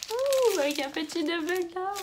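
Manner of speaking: high-pitched squeal of delight; high, gushing little coo. Real, not acted. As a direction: coo high over something adorable. Cooing over a cute gift.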